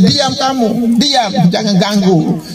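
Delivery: high mock scolding, drawn out at the end; retold shushing. Disciples silencing the beggar, imitated scolding. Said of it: "Murid-muridnya bilang".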